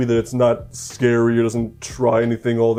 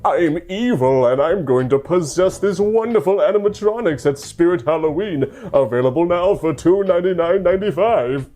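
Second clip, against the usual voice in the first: higher, hammy evil villain voice; sneering panto villain; 5 semitones higher than usual. Retold or imitated. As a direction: ham it up like a cartoon baddie. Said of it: "than just simply be like".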